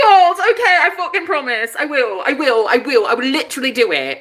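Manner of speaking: high, loud gushing wail; gasp, then squeal and promise eagerly; squealing, easing into emphatic insistence; overwhelmed promise. Retold or imitated. Acting out her overjoyed promise.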